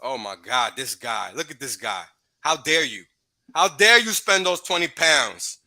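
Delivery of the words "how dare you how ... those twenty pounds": slow, hammed-up indignant "how dare you", much louder; mock outrage. Streamer mocking complainers with slow, loud fake outrage. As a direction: drag it out like a scandalised scold.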